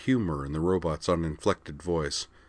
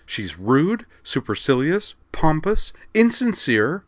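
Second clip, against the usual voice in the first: each insult higher than the last, rising to a shout; building rage; 10 semitones higher, 6 dB louder, slower than usual. A narrator's reading. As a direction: pile the insults up in fury. Mia raging about the counselor.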